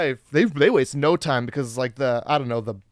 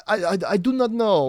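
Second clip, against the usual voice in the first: put-on French accent; 4 semitones higher than usual. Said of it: "in a French accent".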